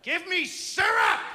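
high, loud, stretched-out demand; mock tantrum yell; angry little girl. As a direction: bellow like a furious toddler. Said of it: "she's a little ball of anger".

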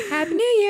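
very high odd tune on "Happy New Year"; imitated intonation. Showing friends how he does it, high mock "Happy New Year". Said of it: "he does do things like that".

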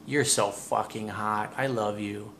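slow, soft and low, like meaning it; low sexy compliment. Shown as a demonstration. Coach showing what to say on video Skype, soft and slow. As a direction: say it slowly and softly, holding her gaze. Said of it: "you go".

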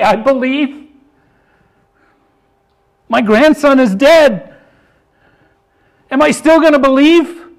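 high, loud, driving pulpit voice; preaching at full force. Acted. Preacher pressing the congregation, in full preaching force. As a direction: pound it home to the congregation.

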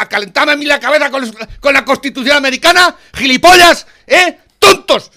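loud, high-pitched yelling rage; furious shout. Real, not acted. Yelling at the journalists until told to calm down, furious. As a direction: scream the insults in real fury.

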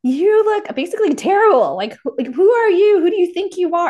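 loud, high drill-sergeant yell; mock drill yell. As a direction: bark at the sloppy cadet. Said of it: "it was like".

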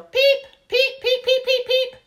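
very high, very loud squeaky peeps; giggly peeping. A narrator's reading. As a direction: chirp madly, out of control. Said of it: "she was giggling very hard".

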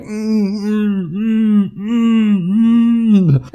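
high, strained humming through a closed mouth; muffled crying hum. Retold or imitated. Dreamer with stitched mouth protesting at his own wedding, high muffled crying hums. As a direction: moan desperately with lips sealed.

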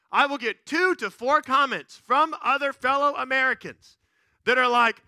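ordinary talk, nothing marked

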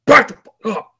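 high, loud burst of intimidation; intense Branch Warren. Retold or imitated. Bodybuilder warning off the people tugging at him, intense. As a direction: bark it suddenly, imitating an angry bodybuilder. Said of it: "intense bass in his voice".

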